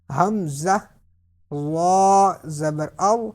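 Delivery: slow drill, long heavy "وا" as emphatic zaa; thick unwhistled dh in "وا", held long; full-mouthed. Shown as a demonstration. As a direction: stretch "وا" heavy and rounded. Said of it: "پر پڑھنا ہے".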